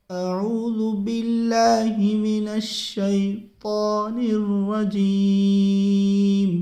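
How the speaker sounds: slow recitation with heavy full-mouth ط in الشیطان. Shown as a demonstration. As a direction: draw it out, filling the mouth on ط. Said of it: "منہ بھر کے پڑھیں گے".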